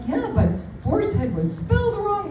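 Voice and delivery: high-pitched retold objection; mock protest. Protesting to Gideon about the spelling, high-pitched.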